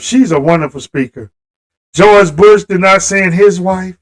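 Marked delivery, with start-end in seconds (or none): 0.0-1.3 sincere aside. Real, not acted. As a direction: lower and much softer, heartfelt. Sincere aside praising Michelle Obama.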